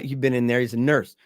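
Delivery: ordinary talk, nothing marked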